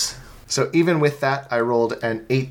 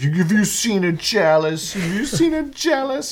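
mocking high squeak, rising higher; mock squeaky voice; 9 semitones higher than usual. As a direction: parrot the question in a squeak. GM mimics the player's squeaky question.

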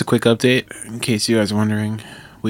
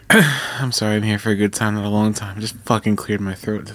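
normal talking voice

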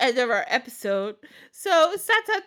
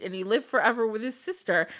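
usual speaking voice

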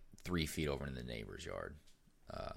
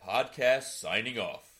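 announcer sign-off; 6 semitones higher, 7 dB louder than usual. Acted. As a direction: close the show like an announcer. Show sign-off.

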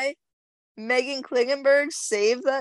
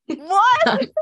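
high, loud shocked "What?"; stunned outcry at the friend; 7 semitones higher, 6 dB louder than usual. Real, not acted. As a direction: cry out in disbelief. Shocked friend doesn't know show.

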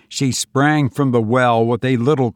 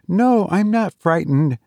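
put-on higher girl's voice, protesting; young woman reassuring; 7 semitones higher than usual. A narrator's reading. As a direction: insist in a higher girlish pitch. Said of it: "said the girl".

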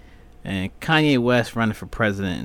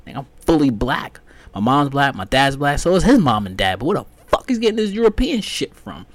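louder, rising exasperation; heated; 5 semitones higher, 5 dB louder than usual. Real, not acted. Protesting his brother's European claim, louder and heated. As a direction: get heated and higher.

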